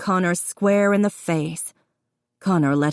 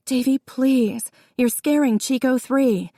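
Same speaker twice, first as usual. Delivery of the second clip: pleading; 7 semitones higher than usual. A narrator's reading. Jessica pleading with her brother, desperate. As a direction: beg him gently to stop.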